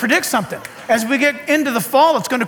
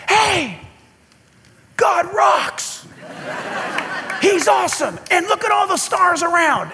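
higher mock shout from a star to the earth; 6 semitones higher than usual. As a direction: cheer it out like the star calling down. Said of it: "He's just shouting".

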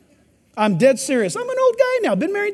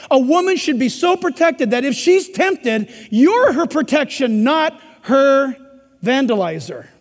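ordinary delivery

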